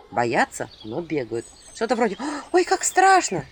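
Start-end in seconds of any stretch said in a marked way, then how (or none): 2.5-3.4 high squeaky fake fright; mock girlish fright. A narrator's reading. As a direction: squeal like a coy village girl. Mocking girls' fake fright.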